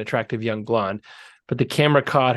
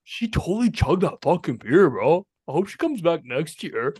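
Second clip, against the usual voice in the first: bro voice; 4 semitones higher than usual. Pretend. Imitating guys watching sports.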